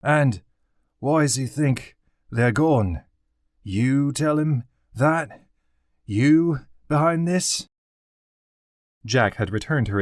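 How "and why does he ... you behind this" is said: breathless, higher, slow accusing; breathless guard voice; out of breath. A narrator's reading. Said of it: "spat breathlessly".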